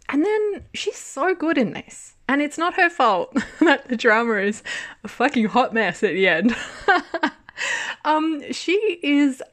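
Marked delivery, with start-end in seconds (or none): 5.0-9.4 laughing. Real, not acted. Laughing at the messy ending.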